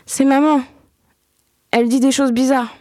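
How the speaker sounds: put-on small boy urging his sister home; worried little boy. A narrator's reading. Axel the little boy voiced.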